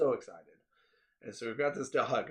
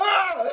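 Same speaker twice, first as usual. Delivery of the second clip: loud high-pitched shriek; loud high laughing shriek; high squeal; 11 dB louder than usual. Retold or imitated. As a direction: shriek like you can't cope. Retelling own panicked squeal.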